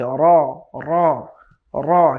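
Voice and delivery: র with a light repeated tap of the tongue; light trilled র. Shown as a demonstration. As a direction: tap র lightly, a quick flutter. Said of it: "repeat sound হচ্ছে".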